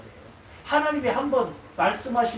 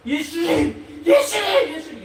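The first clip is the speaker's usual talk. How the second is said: high, loud, building cry; shouting for Jesus; 8 semitones higher, 7 dB louder, slower than usual. Acted. Believer shouts for Jesus until he answers, high and loud.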